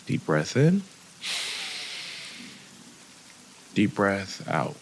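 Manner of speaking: unhurried guide with long pauses between phrases; guided meditation. Acted. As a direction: lead them gently through each breath, softening as the body relaxes. Leads a breathing and body scan.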